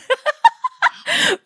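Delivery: helpless, high squealing laughter; high-pitched laugh. Real, not acted. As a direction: crack up at your own confession, pitch way up. Laughing at her own story.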